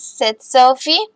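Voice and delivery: usual speaking voice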